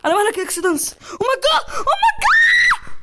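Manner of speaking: high and loud, climbing to a scream; escalating panicked shriek. Real, not acted. Player panicking at the game, escalating shriek.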